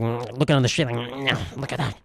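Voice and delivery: mock an ogler muttering to himself; leering regular man. Regular men eyeing passers-by, mock leering mutter. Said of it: "Regular men are just like".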